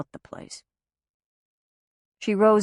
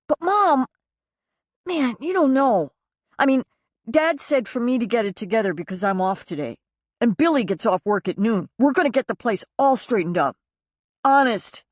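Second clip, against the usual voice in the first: higher, pleading voice of a grown son; pleading young son; 6 semitones higher than usual. Acted. Son begs mother not to judge the mess, pleading. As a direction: beg her to believe him.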